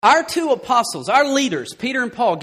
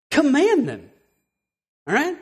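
ordinary talk

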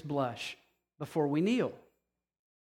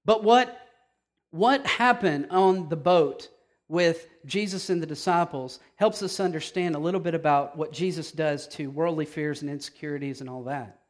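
normal talking voice

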